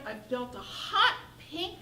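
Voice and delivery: ordinary delivery, nothing marked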